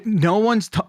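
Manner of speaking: normal talking voice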